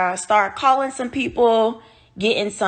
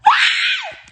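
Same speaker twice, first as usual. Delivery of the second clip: crying wail; 3 dB louder than usual. Retold or imitated. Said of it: "I started that crying".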